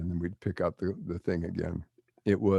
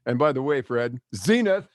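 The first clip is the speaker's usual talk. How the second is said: smart-aleck jab; 5 semitones higher, 6 dB louder than usual. Retold or imitated. Smart-aleck fan in line taunting Fred, loud and teasing. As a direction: needle him with the forbidden word. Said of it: "some smart ass ... would go".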